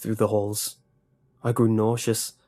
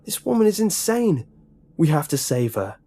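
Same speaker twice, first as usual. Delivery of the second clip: pleading; 7 semitones higher, 3 dB louder than usual. A narrator's reading. Nick insists on rescuing.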